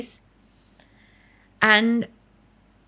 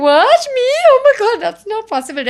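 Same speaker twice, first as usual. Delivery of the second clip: much higher, louder squeal of surprise; stunned disbelief; 12 semitones higher, 8 dB louder than usual. Retold or imitated. Singer retelling hearing of her fame, high disbelief. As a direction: act out the shock of being famous. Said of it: "And I was like".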